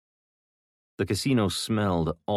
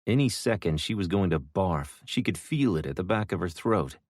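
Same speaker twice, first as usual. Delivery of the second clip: usual speaking voice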